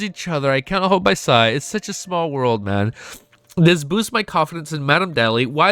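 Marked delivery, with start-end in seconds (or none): none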